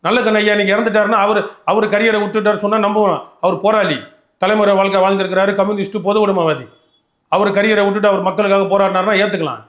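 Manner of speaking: real indignant debate outburst; heated tirade. Real, not acted. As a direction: argue forcefully with voice pushed up and loud. Heated tirade against political rival.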